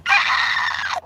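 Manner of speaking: a sharp breathy gasp of shock; stunned gasp. Retold or imitated. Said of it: "Like he just saw [name]".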